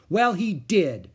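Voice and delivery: put-on slow, loud, high child's insistence; stubborn. A narrator's reading. Little girl insisting to Henry that the dog ate her cookie, stubborn. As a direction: dig in your heels. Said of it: "said Ramona".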